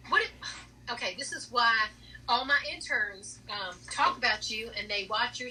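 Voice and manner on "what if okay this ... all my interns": normal talking voice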